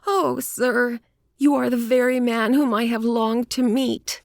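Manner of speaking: high, eager little woman's voice; eager cry. A narrator's reading. Little woman to the detective she longed to meet, eager cry. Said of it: "cried the little woman".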